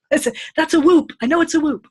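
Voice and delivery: high, loud, certain and alarmed, playfully hammed up; mock panic. Retold panic, sure it's whooping cough.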